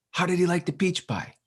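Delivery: hopeful cook asking about her pie; eager mataji. Retold or imitated. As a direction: ask brightly again. Mataji asks about pie again.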